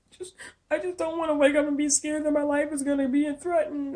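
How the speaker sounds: high, teary mock whimper; mock fake crying. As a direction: imitate her putting on tears. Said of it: "you're still crying with no tears".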